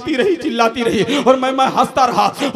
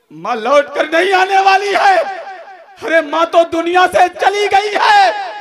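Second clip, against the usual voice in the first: preaching shout; 7 semitones higher, 3 dB louder than usual. Shown as a demonstration. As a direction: thunder it at the congregation. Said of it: "लेकिन माँ लौट कर नहीं आने वाली है". (ऊँची, ज़ोरदार, धीमी उपदेश भरी पुकार)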